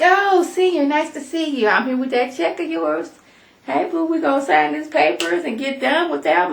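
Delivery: high, sugary put-on voice for Senior; butter him up in a high sweet voice; sweet fake greeting. Quoting Stephanie to Senior.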